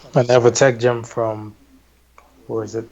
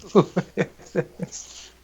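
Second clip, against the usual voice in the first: slow breathy whisper through a laugh; 7 semitones higher, 8 dB quieter, much slower than usual. Real, not acted. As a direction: whisper it again, half laughing.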